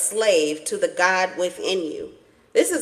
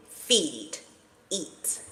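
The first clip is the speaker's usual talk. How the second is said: whispered urging; 4 semitones lower, 7 dB quieter than usual. Real, not acted. Reader urges viewers to feast.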